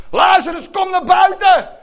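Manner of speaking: a high, loud, ringing summons; commanding shout. Acted. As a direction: call the dead man out of the grave. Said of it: "riep hij met krachtige stem".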